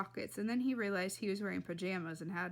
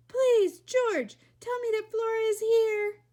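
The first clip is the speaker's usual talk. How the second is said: high, loud frantic begging; screamed plea; 12 semitones higher, 10 dB louder than usual. A narrator's reading. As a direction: scream it as a desperate mother. Frantic mother pleads for her daughter.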